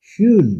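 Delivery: schön with a rounded ö, high and loud; German ö sound. Shown as a demonstration. Teacher demonstrating schön to the class, rounded ö. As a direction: say schön with lips rounded between o and e. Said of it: "ও plus এর মাঝামাঝি".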